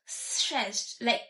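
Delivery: ordinary delivery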